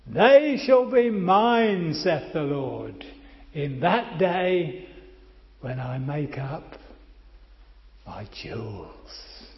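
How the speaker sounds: loud, slow, booming declaration; voice of the Lord. Acted. Preacher booming God's words from Malachi, slow and loud. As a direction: proclaim it slowly with full force. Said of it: "God says".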